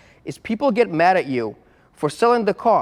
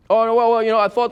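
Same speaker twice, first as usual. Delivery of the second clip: mock flustered caller; 5 semitones higher, 4 dB louder than usual. Mimicking the hesitant caller.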